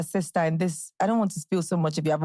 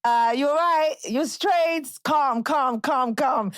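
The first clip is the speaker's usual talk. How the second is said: put-on high-pitched Top Boy London accent; English accent; 8 semitones higher, much slower than usual. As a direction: imitate London roadman slang in an English accent. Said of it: "like, an English accent".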